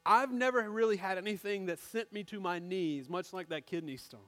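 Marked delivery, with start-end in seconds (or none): none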